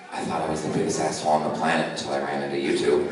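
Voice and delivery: low, fast grumbled insult; lightly voiced drunk trucker; drunk trucker's retort. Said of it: "he goes".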